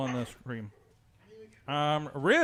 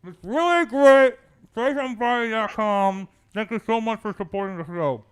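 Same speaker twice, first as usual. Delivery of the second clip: hard-to-understand put-on voice; 5 semitones higher, 6 dB louder than usual. Host hamming up the show's plug, high and garbled. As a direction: start high and loud, smear the words together. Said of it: "We need, like, subtitles".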